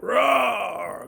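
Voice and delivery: high, long roar sliding down; imitated yelled roar. Man shows how he yelled when he got hit, high roar. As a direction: yell a big animal-like roar. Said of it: "I yelled, like".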